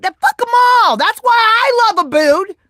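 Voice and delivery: loud, high cheer for a friend; rally the chat behind him; happy defiant yell; excited shout. Real, not acted.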